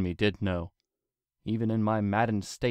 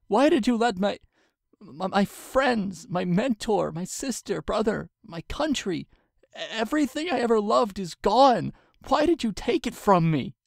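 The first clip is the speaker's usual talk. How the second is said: much higher and louder, voice cracking; screaming grief; 13 semitones higher, 6 dB louder than usual. A narrator's reading. Twilight screams her losses at the king, much higher. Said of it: "I was screaming".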